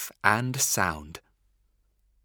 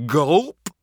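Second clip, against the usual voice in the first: loud swallowing gulp; 5 semitones higher, 8 dB louder than usual. Acted. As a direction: gulp it down with a big noise. Wolf swallows grandma.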